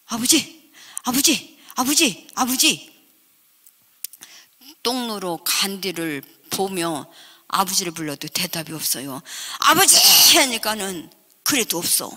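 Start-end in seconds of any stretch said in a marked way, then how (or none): 0.1-3.0 higher-pitched repeated calls; calling out. Retold or imitated. Frightened child to missing father in the mountains, calling out. 9.6-10.0 high, loud long-distance call; shouted call. Retold or imitated. Child to father lost in the woods, shouting out.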